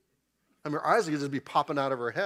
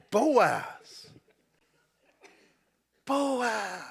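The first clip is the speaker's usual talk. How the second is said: excited; 8 semitones higher, 3 dB louder than usual. Acted. Naomi savours Boaz's name.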